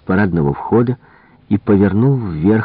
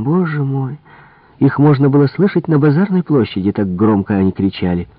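higher, animated servant voice; gossiping maid; 7 semitones higher than usual. Acted. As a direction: dish about the master's temper. Maid gossips about master's temper.

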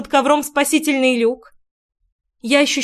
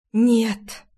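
hopeless sigh. A narrator's reading. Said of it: "обречённо выдохнула".

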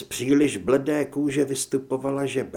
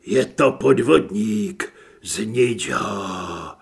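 much lower, slow, otherworldly; another changed voice; 5 semitones lower, 4 dB louder, much slower than usual. Acted. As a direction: intone it like a demon. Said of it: "Další změna".

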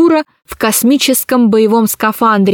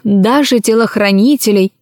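usual speaking voice

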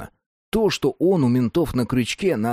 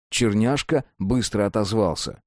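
ordinary delivery

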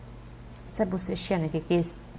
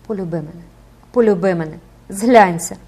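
building from a plea to a loud cry; shouting plea; 7 dB louder than usual. A narrator's reading. The priest begs the girl for love, plea building to a shout. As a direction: beg harder with each phrase. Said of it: "кричав нещасний священик".

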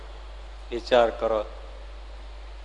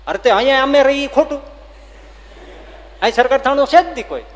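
much higher, louder and fast; raised, quick argumentative voice; heated denial; 11 semitones higher, 6 dB louder, faster than usual. Acted. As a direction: rush out the denial. Horseman insists no station.

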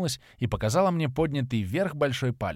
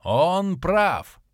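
loud, higher booming call; booming guardian; 6 semitones higher, 5 dB louder than usual. A narrator's reading. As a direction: let it roll over the land. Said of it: "пронёсся по округе голос хранителя". (громовой голос хранителя)

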